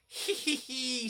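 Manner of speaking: high, slow sly snigger. A narrator's reading. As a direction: giggle at your own trick. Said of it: "sniggered Sir Handel".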